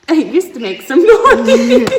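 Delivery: cracking up at the toy; laughing. Real, not acted. Teacher laughs at the broken toy hammer, laughing.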